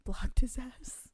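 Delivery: breathy whispered boast, slow; whispered punchline. Real, not acted. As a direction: drop to a whisper to land the punchline. Proud punchline of blocking story.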